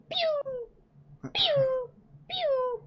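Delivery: very high-pitched falling pew; imitated zooming noise. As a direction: mimic something zipping past, three times. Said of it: "that sucker goes".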